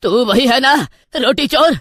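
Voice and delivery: put-on old beggar shouting in rage; angry yelling. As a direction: yell at the thief who stole your bread. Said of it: "उस पर चीखने चिल्लाने लगा".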